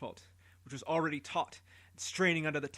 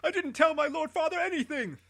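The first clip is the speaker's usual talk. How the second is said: much higher, loud desperate pleading; screaming protest; 14 semitones higher, 7 dB louder than usual. Acted. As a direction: scream it as you are about to die. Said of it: "screaming and crying and shouting".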